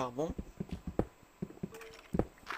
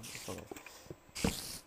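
slow, soft whispered brush-off; whispered aside; 7 semitones lower, 10 dB quieter, much slower than usual. Real, not acted. Whispering an aside about the cat. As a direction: drop it to a whisper.

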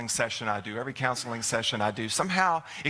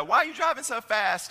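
much higher, a mock officer voice; playfully imitating a cop who pulled you over; light mock police voice; policeman's question; 12 semitones higher than usual. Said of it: "the police pulls you over and says".

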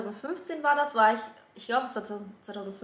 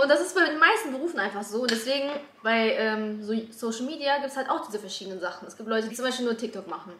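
ordinary talk

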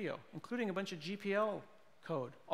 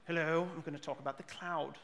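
refined British presenter impression; British accent; 3 dB quieter than usual. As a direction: greet the audience in a British accent. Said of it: "just because he's British".

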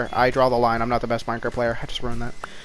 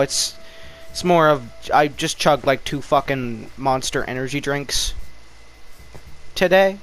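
ordinary talk

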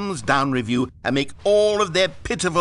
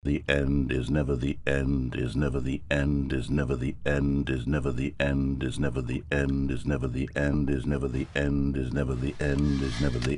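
much lower, dead level chant; endless monotone loop; 13 semitones lower than usual. Acted. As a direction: repeat it like a stuck record. Looping phrase repeats endlessly.

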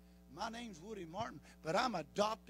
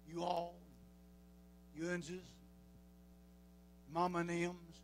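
showing off southern hillbilly speech; Appalachian drawl as a demo; southern hillbilly pronunciation; hillbilly talk; much slower than usual. Shown as a demonstration. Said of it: "people make fun of us hillbillies, the way we talk".

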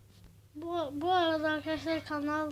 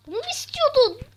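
much higher and louder mock shout; angry yell; 10 semitones higher, 9 dB louder than usual. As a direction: yell it at the screen in fake rage. Yelling at the game "baba. (oyuna bağıran sahte öfke)

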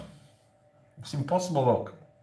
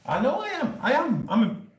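mock boast; 13 semitones higher than usual.

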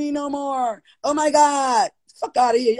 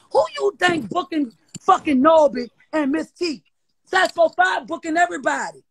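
ordinary talk, nothing marked

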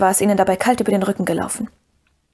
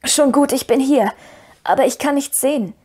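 higher, faint answering call; weak voice; 5 semitones higher than usual. A narrator's reading. Fallen Frodo answers Sam from below, in a weak voice. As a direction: answer weakly from far below. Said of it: "Frodos Stimme war schwach".

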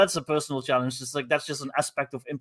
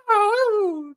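very high witch screech; Wicked Witch. Retold or imitated. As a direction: shriek it like a storybook witch. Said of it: "like, the Wicked Witch".

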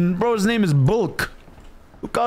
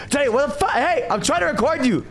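yelling; 10 semitones higher than usual. Real, not acted. Yelling at teammate in game.

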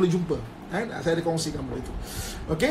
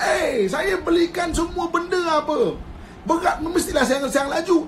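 higher, a mock exasperated husband; mock indignant husband; 7 semitones higher than usual. Husband protests to his wife that he bought everything, mock exasperated. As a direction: protest like a wronged provider.

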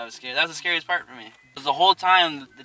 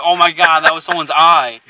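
louder, startled exclamation; reliving the fright of the flash; retold shock; 3 semitones higher, 12 dB louder than usual. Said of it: "and I'm like".

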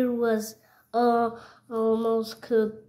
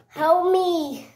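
high mock plea for rescue; cry for help; 6 semitones higher, 4 dB louder than usual. Trapped character calls for rescue as it's being cooked, high cry for help. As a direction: call out in pretend distress, higher.

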